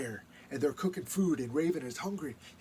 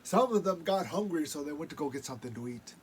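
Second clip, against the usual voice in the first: story narration; 8 dB louder than usual. A narrator's reading. Storyteller telling legend.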